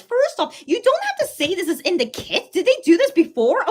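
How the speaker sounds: high-pitched, indignant complaint; upset protest. Real, not acted. Fan upset over the kit wording, high indignant protest. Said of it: "makes me a little bit upset".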